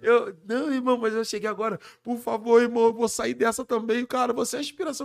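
high, loud, urgent pleas; loud emotional pleading. Retold or imitated. Fans and the MC pleading with each other in tears, loud. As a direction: plead loudly over the crowd. Crowd weeping, pleading loudly.

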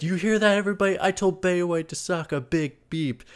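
high, gleeful mock boast; gleeful Ryan. Ryan bragging to the set in a high gleeful mock voice. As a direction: crow it to the whole room. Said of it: "then he's like".

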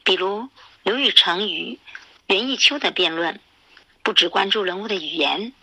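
ordinary delivery, nothing marked

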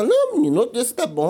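a hint of the reassuring doctor; doctor's voice.

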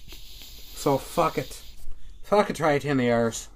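proud, hammed-up Irish brogue; mock Irish accent. Host boasting about pride and Guinness, mock Irish accent. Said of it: "doing some stuff with the Irish accent there".

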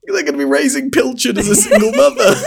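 high, laughing through each word. Real, not acted. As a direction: push the words out mid-laugh.

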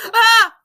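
short, high, loud "Ah!" of shock; hammed-up startled yelp; mock scream. Acting him screaming at the news.

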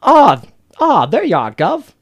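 high, loud put-on character accent; Butcher. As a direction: exaggerate the accent on a swooping, high greeting. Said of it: "his fucking accent for Butcher".

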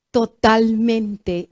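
"totalmente" drawn out slowly, falling; slow emphatic repeat. Shown as a demonstration. Preacher repeats to stress Elisha's lesson, slowly drawn out. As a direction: stretch "totalmente" out for weight.

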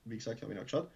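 usual speaking voice

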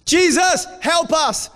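very high, loud call for help; desperate cry. Acted. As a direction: yell after someone who's walking away. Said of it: "They're calling".

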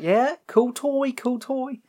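very high, indulgent praise; mock humouring. Family to Leatherface with his toy, humouring. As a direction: humour a child showing off.

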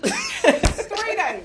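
high emphatic repeat. Real, not acted. Joking to friend about marathon calls, high emphatic repeat. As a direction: throw it up high the second time.

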